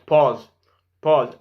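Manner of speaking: usual speaking voice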